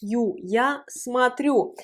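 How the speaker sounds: смотрю slowly, its о sounded as "a"; unstressed O as A. Shown as a demonstration. Teacher showing the vowel change, смотрю with о as A. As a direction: say смотрю as "sma-TRYU", drawn out. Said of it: "elle se prononce A".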